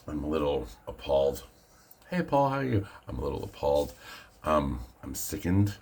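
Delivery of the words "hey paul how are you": high mock greeting. Pun greeting on appalled.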